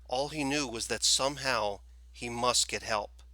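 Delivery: ordinary delivery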